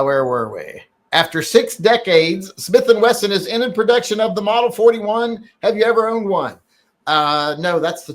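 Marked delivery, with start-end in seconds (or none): 1.1-6.6 raised, louder announcing read; headline read. Acted. Host reading a viewer's news question to chat, raised and announcing.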